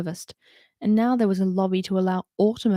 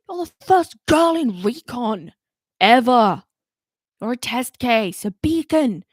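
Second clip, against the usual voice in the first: stern commander; 4 semitones higher, 6 dB louder than usual. A narrator's reading. Commander's earnest speech.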